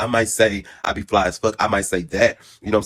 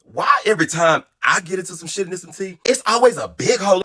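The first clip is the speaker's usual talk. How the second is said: exasperated; 8 semitones higher than usual. Retold or imitated. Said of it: "I'm like".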